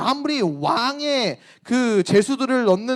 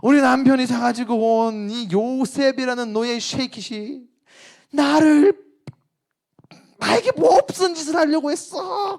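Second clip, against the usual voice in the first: higher, shaken mock-victim voice; acted false accusation; 5 semitones higher, 3 dB louder than usual. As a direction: play the wronged wife, overdone. Wife falsely accuses Joseph. (억울한 척 과장된 목소리로)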